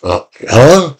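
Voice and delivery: put-on questioning, confused child's "huh?"; baffled kid. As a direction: act out a kid suddenly thrown off, unsure. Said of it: "doesn't know what to do".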